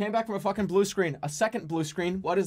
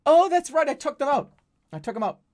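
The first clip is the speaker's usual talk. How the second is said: sudden realization; 7 semitones higher, 4 dB louder than usual. Retold or imitated. At the register, realizing the cards are at home, sudden remembering. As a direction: pitch jumps high, then sinks. Realizing his own mistake.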